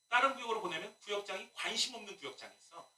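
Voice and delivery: normal talking voice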